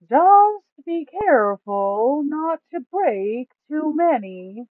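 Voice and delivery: high, loud, slow put-on voice for the greedy Duke. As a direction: drag the words out, high and loud, savouring the loot. Duke warns Kraxus.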